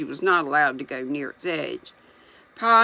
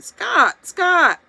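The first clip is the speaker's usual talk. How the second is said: shouting; 14 semitones higher, 9 dB louder than usual. A narrator's reading. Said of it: "he shouted".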